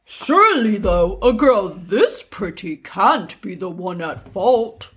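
swooping, hammed-up gentleman; man voice. Prince addressing the widow, put-on man voice. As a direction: put on a manly prince with big pitch swings. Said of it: "I'm gonna do my man voice".

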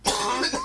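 coughing fit breaking into words; cough, then push the word out; chesty cough. Real, not acted. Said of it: "gonna cough up a lung".

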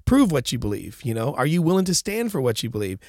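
ordinary talk, nothing marked

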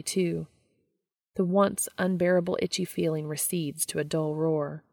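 plain reading voice

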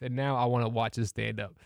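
ordinary talk

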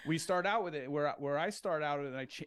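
usual speaking voice

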